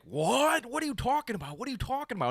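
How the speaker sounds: high, incredulous shock; can't believe it, pitch up high; disbelief. Retold or imitated. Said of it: "And he was like".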